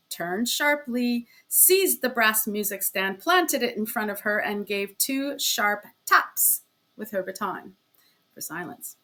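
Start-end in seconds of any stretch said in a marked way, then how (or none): none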